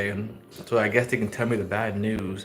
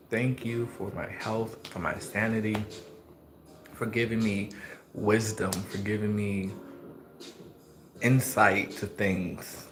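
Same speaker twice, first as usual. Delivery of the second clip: soft, earnest prayer voice; 4 dB quieter than usual. Acted. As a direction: pray quietly and sincerely. Prays aloud to the Lord.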